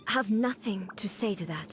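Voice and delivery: unvoiced, hushed admission; whisper. Shogun whispers she has no answer to Miko. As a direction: whisper it as if caught out.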